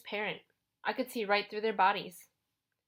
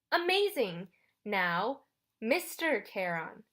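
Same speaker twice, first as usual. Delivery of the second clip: condescending teacher; slower than usual. Acted. Charon teaches the boy his title, condescending. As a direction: stretch "Mister Charon" as if teaching a child. Ferryman schooling boy on name.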